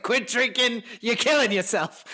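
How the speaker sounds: high, mock-stern nagging son. Host imitating cohost nagging his mom to quit drinking. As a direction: lay down the law to mom.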